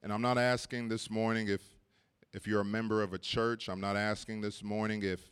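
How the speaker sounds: pastor inviting the congregation forward, gently; gentle altar call. Acted. Altar call with heads bowed.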